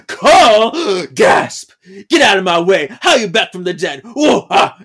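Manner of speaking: loud mock-dramatic outburst with gasps; hammed-up shock. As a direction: play a melodramatic startled confrontation, shouted. Acting out Paige's shocked confrontation.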